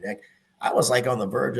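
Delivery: ordinary talk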